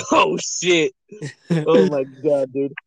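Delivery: really cracking up while talking; words squeezed out through laughter; laughing. Real, not acted. Cracking up at drunk friend story.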